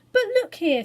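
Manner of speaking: high, loud insistence; insistent call. Acted. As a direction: grab their attention, loud and high. Robert insists on testing.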